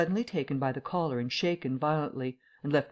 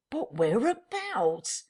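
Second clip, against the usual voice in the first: interrupting tramp; 8 semitones higher, much slower than usual. Acted. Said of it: "interrupted Mister Marvel".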